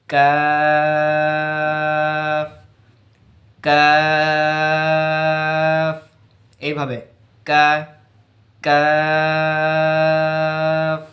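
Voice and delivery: কাফ drawn very long with the tongue further forward; slow Arabic kaf demo. Shown as a demonstration. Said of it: "এইভাবে উচ্চারণ করতে হবে".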